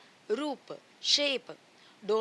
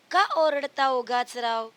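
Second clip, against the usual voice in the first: put-on sing-song, louder recital of a children's poem; poem recitation; 6 dB louder than usual. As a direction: recite the verse loudly for kids. (बच्चों को कविता सुनाते हुए, ज़ोर से गाकर पढ़ना)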